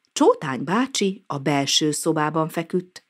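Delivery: ordinary delivery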